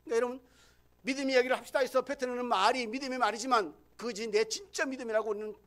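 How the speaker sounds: loud, driving pulpit delivery; full-force preaching. Acted. Pastor preaching hard to his congregation, loud and driving. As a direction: press each point on the congregation.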